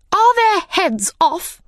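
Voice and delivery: high, loud impatient demand; shouted question. Acted. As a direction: bark the question across the garden. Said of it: "shouted the Queen".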